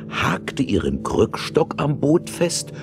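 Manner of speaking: ordinary delivery, nothing marked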